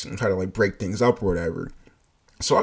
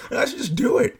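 much higher, mock-giddy inner voice; high tempted thought; 13 semitones higher than usual. [name]'s inner voice urging him to hit her. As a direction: tempt yourself playfully. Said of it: "conversation with myself".

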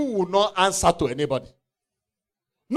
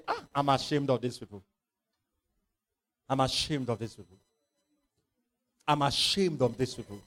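ashamed God; 7 semitones lower, 8 dB quieter than usual. Acted. God looking down at people.

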